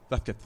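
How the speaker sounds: Vázquez with the z said as th, VATH-keth; mock Spain Spanish Vázquez; Vázquez lisped the Castilian way; Castilian pronunciation. Shown as a demonstration. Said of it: "Are we going to say".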